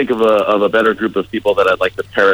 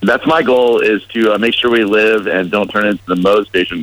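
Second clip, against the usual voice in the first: usual speaking voice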